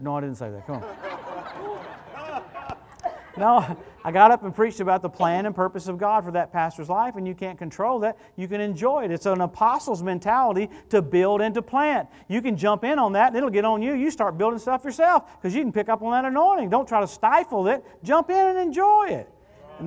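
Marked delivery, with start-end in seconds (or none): 0.9-2.7 a breathy, quiet laugh at his own joke; soft laugh. Real, not acted. 3.4-19.3 rising, louder preaching that builds to a high cry; preaching at full force. Acted.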